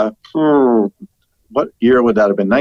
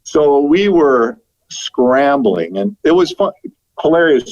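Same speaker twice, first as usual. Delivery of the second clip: usual speaking voice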